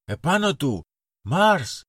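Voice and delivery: little captain yelling charge; charge command. A narrator's reading. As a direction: send the troops charging. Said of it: "διάταξε πάλι ο λοχαγός".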